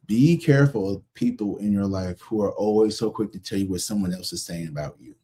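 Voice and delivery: ordinary talk, nothing marked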